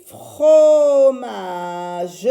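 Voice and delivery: "fromage" very slow and loud, split fro-ma-ge; syllabes étirées. Shown as a demonstration. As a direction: hold each syllable of "fromage", sounding the final e. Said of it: "J'ai dit ici". (« fromage » très étiré, en trois syllabes)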